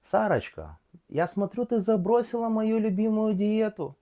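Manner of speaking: put-on nagging aunt in a thick Odessa accent. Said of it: "Чисто одесский анекдот".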